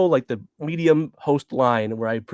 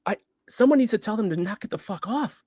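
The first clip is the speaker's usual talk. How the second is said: ordinary talk, nothing marked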